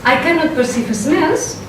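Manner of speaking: ordinary talk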